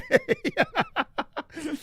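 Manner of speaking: high-pitched helpless laughter; real laugh. Real, not acted. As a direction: crack up completely. Laughing at his own story.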